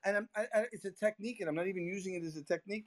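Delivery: ordinary talk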